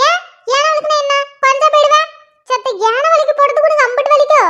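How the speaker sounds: lightly voiced nagging mother; mother scolding. Mother finds her daughters sitting idle, nagging. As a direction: grumble at the lazy girls like their mum.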